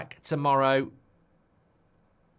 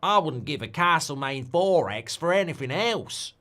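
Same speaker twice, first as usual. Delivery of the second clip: put-on lively, swooping Aussie accent, higher and louder; Australian accent; 5 semitones higher, 4 dB louder than usual. Streamer attempting an Australian line on request, Australian accent. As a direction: have a go at a broad Australian. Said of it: "Can't do Australian".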